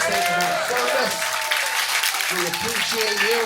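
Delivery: rally the room behind him; loud cheer. Real, not acted. Comedian cheering a veteran for the crowd, loud shout.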